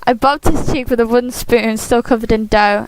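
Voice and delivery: ordinary delivery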